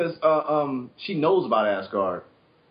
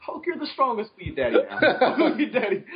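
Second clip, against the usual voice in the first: laughing mock voice; 11 semitones higher than usual. Mocking kid forced to praise.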